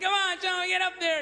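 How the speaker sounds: high, pushy trainer bark; trainer yelling at elephant. Retold or imitated. Circus trainer whipping the elephant, high urging. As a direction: drive the elephant on, high and loud. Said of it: "whipping the elephant".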